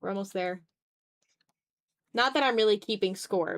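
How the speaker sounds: normal talking voice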